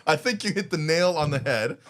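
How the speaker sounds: loud, laughing through the words; can't stop laughing while talking. Real, not acted. Laughing at her own joke.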